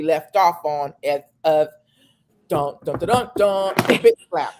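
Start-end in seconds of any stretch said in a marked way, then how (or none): 2.5-4.5 mock suspense build to a shout; dramatic drumroll reveal. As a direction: build suspense, then burst out. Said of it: "dramatic reveal drumroll".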